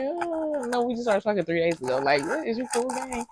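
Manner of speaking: high incredulous pushback that settles; you can't believe what he just said; mock-shocked, is he okay; stunned disbelief. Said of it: "And I was like".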